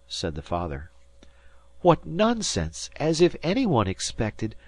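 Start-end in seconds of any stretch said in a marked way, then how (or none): 1.8-4.5 high-pitched exasperated mother; indignant scoff. A narrator's reading. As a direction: scoff at a foolish idea.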